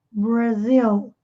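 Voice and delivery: "Brasil" said the English way, with a curled English R. Shown as a demonstration. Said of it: "o R é enrolado".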